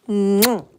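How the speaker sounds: a big smacking air kiss; loud kiss. Real, not acted. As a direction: blow the viewers a loud kiss. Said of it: "give yous a big kissy".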